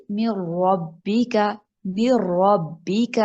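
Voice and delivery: very slow من ربک with nun fully merged into ra, no ghunna; no nun sound. Shown as a demonstration. Teacher demonstrating full merge, very slow recitation. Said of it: "نون کی sound نہیں آ رہی".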